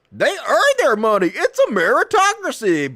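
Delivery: high, loud parody voice; scornful high-pitched mimicry; mocking defender. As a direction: ape a naive apologist, hammed up. Mocks defenders of billionaires.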